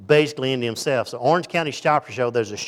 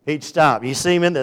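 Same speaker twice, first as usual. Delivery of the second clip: normal talking voice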